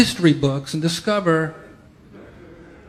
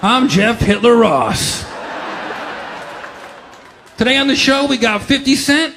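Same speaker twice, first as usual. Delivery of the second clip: game show host; 4 semitones higher than usual. Pretend. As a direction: boom it out like a hammed-up show host. Continuing as Soul Train host.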